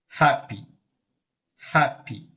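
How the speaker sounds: "Happy" with HAP stressed, short break before -py; stress on first syllable. Shown as a demonstration. Teacher shows the stress mark, HAP-py stressed on the first syllable. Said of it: "This is test mark".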